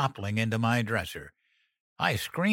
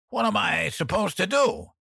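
desperate shout; 9 semitones higher than usual. A narrator's reading. Dan's panic peaks.

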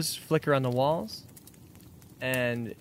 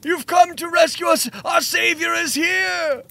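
worshipping cultist; 6 dB louder than usual. Acted. GM voices worshipping cult people.

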